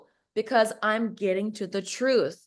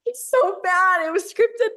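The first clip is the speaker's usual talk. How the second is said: high, loud exclamation; loud squeal of disbelief; real exasperated outburst; 11 semitones higher, 6 dB louder than usual. Real, not acted. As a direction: burst out, pitch leaping way up. Reacting to the scripted call.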